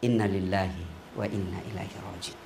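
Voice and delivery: low, hushed recitation of the condolence formula; solemn murmur. Acted. Preacher mourning before the women, hushed and low. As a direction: breathe it out low like hearing sad news.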